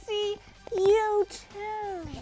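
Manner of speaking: slow, high delighted gush. Real, not acted. Overjoyed at seeing friends pictured on the gift. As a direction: savour it, overjoyed.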